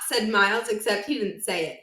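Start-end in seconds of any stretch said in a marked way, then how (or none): none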